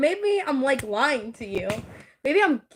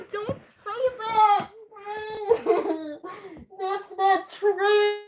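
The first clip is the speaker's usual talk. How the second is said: high, drawn-out whine through laughter; laughing protest; 6 semitones higher, slower than usual. Real, not acted. Streamer begs chat not to say that, laughing protest. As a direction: plead slowly, breaking into laughs.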